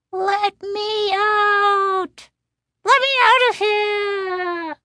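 very high, slow, stretched cry; tiny trapped voice. A narrator's reading. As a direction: wail like a trapped little doll. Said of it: "it cried".

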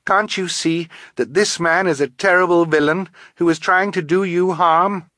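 high, loud, urgent appeal; insistent, rising character voice; pleading girl. A narrator's reading. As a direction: plead with them to see the danger. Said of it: "Violet asked Bruce".

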